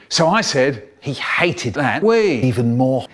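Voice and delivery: ordinary delivery, nothing marked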